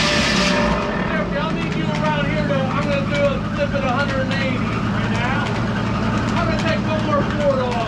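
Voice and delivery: shouting over loud machinery; calling out. Real, not acted. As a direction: call across the noisy mill. Calling over running sawmill.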